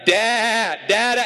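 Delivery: high, loud imitated screaming; toddler scream. Father mimics son screaming and shaking crib, high toddler yell. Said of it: "And he's like".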